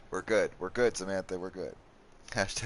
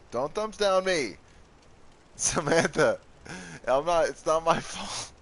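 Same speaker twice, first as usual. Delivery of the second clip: playfully wounded protest; mock outrage; 7 semitones higher, slower than usual. Protesting to chat member Samantha's thumbs down, mock outrage.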